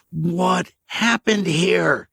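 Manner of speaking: very slow, high, pressing; slow demand. Acted. K J pressing the stranger, slow. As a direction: drag out each word of the demand.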